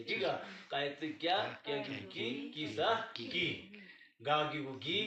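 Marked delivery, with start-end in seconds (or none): none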